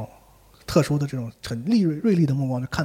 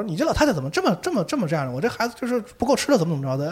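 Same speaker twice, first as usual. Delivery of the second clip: annoyed; 7 semitones higher than usual. Retold or imitated. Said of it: "不太高兴了".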